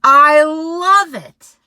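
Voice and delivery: happy hungry bear; delighted bear. Acted. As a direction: gush over it.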